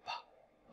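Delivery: "p" whispered, only air and no voice; just air for "p", no voice at all; breath-only P. Shown as a demonstration. Teacher showing English "p" as pure breath. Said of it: "Nó chỉ có hơi thôi nhá".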